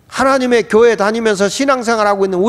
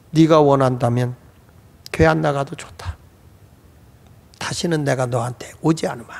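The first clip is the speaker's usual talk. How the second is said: low voice sinking softer to the end; quiet resignation; 4 semitones lower, 3 dB quieter than usual. Retold or imitated. Mother promising her daughter not to ask anymore, low quiet resignation. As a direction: give up gently, with quiet finality. Mother gives up asking, sorrowful.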